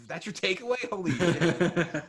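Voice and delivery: high, loud disbelief, breaking into laughter; laughing, exaggerated disbelief; mock incredulity. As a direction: blurt it out in amused outrage. Acted incredulous reaction to Rachel's remark.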